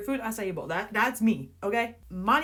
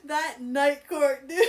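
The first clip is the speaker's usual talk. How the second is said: higher, slow, laughing through it; cracking up; 4 semitones higher, slower than usual. Real, not acted. Reader cracking up at Rune's joke. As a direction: let the laugh take over.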